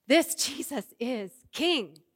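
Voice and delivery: slow, loud proclamation; loud slow cry to the whole world. Acted. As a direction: cry it out slowly and loudly. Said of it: "cries out in a loud voice".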